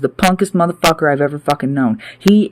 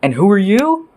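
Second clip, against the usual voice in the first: higher pitch, rising challenge; irritated retort; 4 semitones higher than usual. Retold or imitated. Him answering people who piss him off, irritated retort. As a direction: throw it back as a pointed question. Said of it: "piss him off and make him go".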